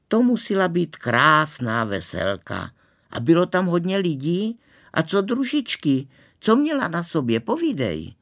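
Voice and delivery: put-on high, eager girlish questioning; excited. A narrator's reading. Girl begging a boy to describe the wedding, excited. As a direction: gush and pry for every detail.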